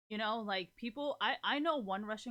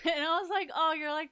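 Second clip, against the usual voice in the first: laughing; 7 semitones higher, 9 dB louder than usual. Real, not acted. Laughing while retelling compliment.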